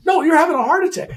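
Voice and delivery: high, loud urgent insistence; panicked nurse. Retold or imitated.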